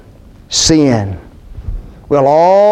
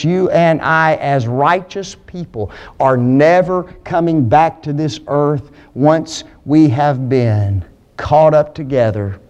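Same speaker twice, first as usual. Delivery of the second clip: ordinary talk, nothing marked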